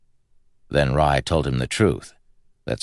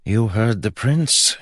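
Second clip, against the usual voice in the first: slow, higher-pitched grumble; grumbled; 7 semitones higher, slower than usual. A narrator's reading. Prince's brother to hesitant guards, grumbled. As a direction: grumble the order wearily. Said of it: "he grumbled".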